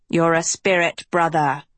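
low, scornful villain voice; scowling. A narrator's reading. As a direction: sneer it at his brother. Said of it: "the schoolmaster scowled".

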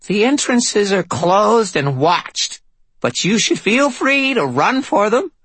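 high, slow demonic rasp; possessed voice over speakers; Constance. Acted. Drulinda over PA.

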